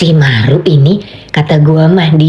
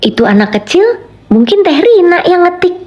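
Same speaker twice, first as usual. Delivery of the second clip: second half jumps much higher; rising intonation; 11 semitones higher than usual. Shown as a demonstration. Streamer redoing the chat line for viewers, pitch leaping higher. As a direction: lift "mungkin Teh Rina yang ngetik" far up. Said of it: "Nah, ulang lagi".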